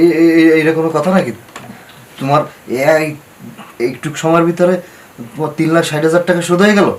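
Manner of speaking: stammer, then protest loudly; indignant disbelief. Acted. Lender disputes that the whole debt is cleared, indignant disbelief.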